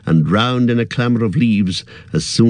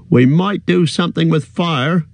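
eager cry; 7 semitones higher than usual. A narrator's reading. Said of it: "cried Sam".

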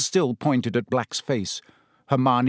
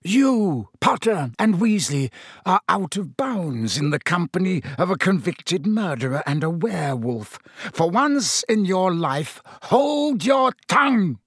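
higher, building to a furious command; spitting anger; 6 semitones higher than usual. A narrator's reading. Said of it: "Snape spat".

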